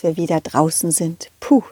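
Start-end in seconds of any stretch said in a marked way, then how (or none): none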